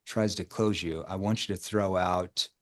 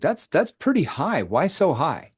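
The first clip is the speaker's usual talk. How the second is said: surprised tone; 6 semitones higher, slower than usual. Shown as a demonstration. Coach to prospect rating nine, playfully surprised. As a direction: pitch jumps up in mock surprise. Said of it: "See how I shift the tone?".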